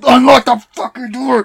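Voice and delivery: high, loud reenacted shout; hammed-up sibling rage; imitated furious yell. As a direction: yell it as if pounding on a door. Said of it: "pounding on the door, like".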